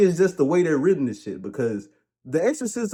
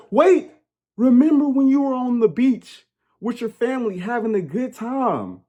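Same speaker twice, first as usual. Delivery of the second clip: high, pleading reminder; lightly voiced urgent plea; mock Lorraine; 8 semitones higher than usual. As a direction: coax the mother back gently. Lorraine quoted in exorcism.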